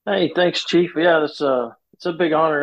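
normal talking voice

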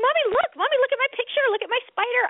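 put-on high-pitched kid begging for attention; excited child. Mom imitating her child showing off a picture, high pestering kid voice. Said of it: "another child's like".

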